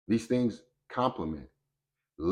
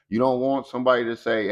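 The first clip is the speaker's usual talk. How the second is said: ordinary talk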